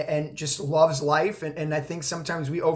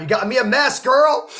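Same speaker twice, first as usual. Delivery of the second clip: teary, moved; 7 semitones higher, 7 dB louder than usual. Real, not acted. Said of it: "I'm all crying".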